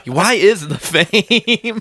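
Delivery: slow mock repeat. Shown as a demonstration.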